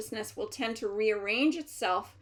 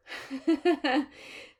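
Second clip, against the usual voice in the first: short laugh; 5 semitones higher than usual. Real, not acted. Laugh mid-channeling.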